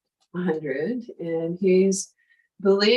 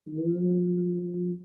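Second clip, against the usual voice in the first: bumblebee humming; 4 dB quieter than usual. Shown as a demonstration. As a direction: hum softly like a bumblebee. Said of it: "making a humming sound like a bumblebee".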